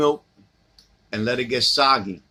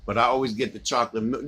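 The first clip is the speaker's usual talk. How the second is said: ordinary talk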